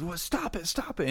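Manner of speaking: high-pitched mild panic; alarmed prisoner. Acted. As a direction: urge them to stop, high and urgent. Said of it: "And he goes".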